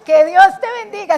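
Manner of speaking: tossed-off blessing said like ciao; mock casual bye; casual goodbye blessing. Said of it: "así como diciendo el chao".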